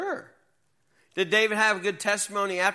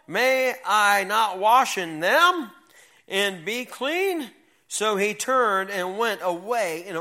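dramatic scripture reading; 2 semitones higher, 4 dB louder than usual. Acted. Teacher reading Naaman's indignant words to the youth, emphatic read. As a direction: let Naaman's complaint rise as you read.

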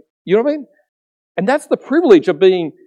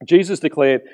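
ordinary delivery

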